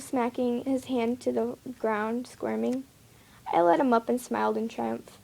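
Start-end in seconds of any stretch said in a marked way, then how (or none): none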